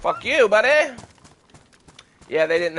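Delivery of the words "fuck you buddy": high, loud taunting yell; taunting shout. Real, not acted. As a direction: yell it at the attacker. Fighting a wolf.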